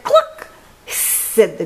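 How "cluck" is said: very high, squeaky cluck; hen's cluck. A narrator's reading. Hen celebrates the capture, clucking very high. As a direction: cluck at the top of the voice. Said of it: "and the hen said".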